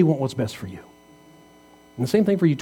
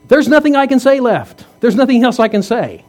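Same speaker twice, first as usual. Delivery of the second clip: higher and louder, throwing up his hands in words; exasperated outburst; 7 semitones higher, 5 dB louder than usual. Real, not acted. Preacher venting to the congregation, exasperated outburst. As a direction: vent the frustration, pitch climbing.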